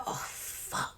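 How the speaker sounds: soft whispered oh no; whispered dismay. Retold or imitated. Friend realising the officer found her age, whispered dismay. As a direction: breathe it out in dread.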